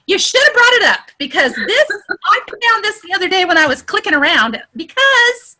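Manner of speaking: excited high-pitched insistence; voice going up. Real, not acted. Trainer telling the group they should have shared it, voice going up. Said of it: "My voice goes up".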